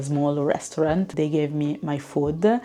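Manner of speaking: ordinary talk, nothing marked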